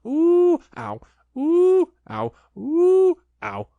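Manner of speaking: high, loud bouncing yelps; ooh ow yelps. A narrator's reading. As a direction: yelp with every jump. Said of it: "he was saying".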